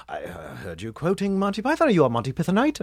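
put-on British accent, lightly mocking; fake British accent. Anglophile classmate asking a freshman about Monty Python, fake British accent. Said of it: "faked a British accent".

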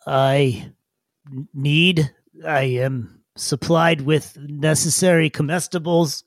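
slow, stammering formal creature; stiff, awkwardly precise little digger; halting digger. Acted. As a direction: pick out each word with effort. Game master plays Brillo.

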